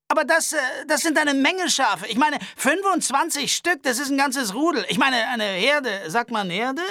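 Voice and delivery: high-pitched nervous Albert voice. Acted. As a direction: complain fussily and fast. Albert rambles about sheep.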